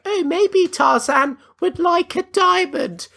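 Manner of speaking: slow, very high-pitched woman impression; mock female voice. Woman setting up a flashback about Tarzan, high mock voice. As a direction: suggest it in a silly high falsetto. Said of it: "she's like".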